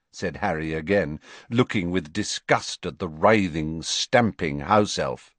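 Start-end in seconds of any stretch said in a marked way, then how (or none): none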